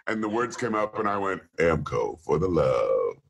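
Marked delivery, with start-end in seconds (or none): 1.6-3.2 very low, slow soul-crooner ad read. Acted. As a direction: drop deep and draw the slogan out smoothly.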